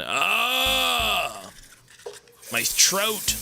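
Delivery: high strained cry of pain. Acted. Character cries out in pain.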